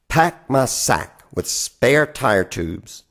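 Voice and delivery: ordinary talk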